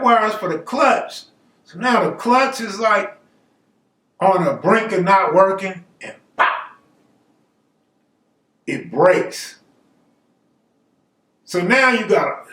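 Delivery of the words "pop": sudden popping sound effect; imitated pop. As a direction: make the pop like a cable breaking. Imitates the cable snapping.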